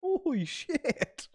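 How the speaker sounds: stunned. Real, not acted. Reacting to the murders.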